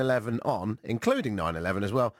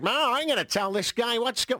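put-on high-pitched accidental American accent; botched impression slipping into American; Theresa May; 12 semitones higher than usual. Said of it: "got an American accent".

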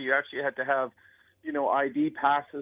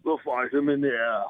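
botched wartime orator with a Terminator edge; Winston Churchill. Acted. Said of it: "Winston Churchill meets Terminator".

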